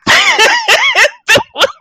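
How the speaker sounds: high, loud burst of laughter; shrieking laugh. Real, not acted. Friend retelling the gas station fight, shrieking laugh. Reacting to the punch story.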